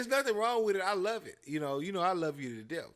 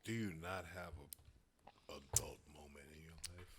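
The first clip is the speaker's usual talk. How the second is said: much lower, whispered scorn; whispering; 11 semitones lower, 13 dB quieter than usual. Pretend. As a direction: breathe it out with contempt. Mock villain banter.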